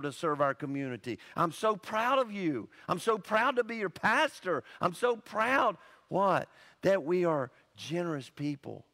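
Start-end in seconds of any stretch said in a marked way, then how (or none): none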